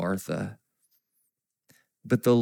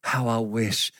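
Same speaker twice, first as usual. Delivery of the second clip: mild weeping; 4 semitones lower, 6 dB quieter than usual. Retold or imitated. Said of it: "And he's weeping as he says that".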